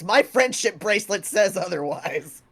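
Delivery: lightly voiced character, laughing through; a hint of a put-on voice; bramble. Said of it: "Briar voice".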